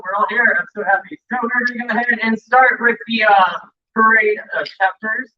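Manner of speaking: upbeat emcee welcome to the crowd; host announcing. Acted. Host welcoming the Starfleet Summit crowd, upbeat emcee. Hosting convention opening announcements.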